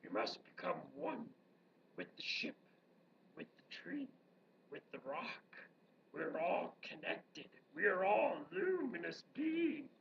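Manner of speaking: low, soft Yoda imitation; a hushed Yoda impression for the class. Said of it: "where Yoda says to Luke Skywalker".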